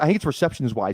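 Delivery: ordinary talk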